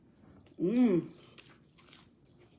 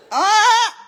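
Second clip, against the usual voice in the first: loud exclaim; 13 semitones higher, 10 dB louder than usual. Real, not acted.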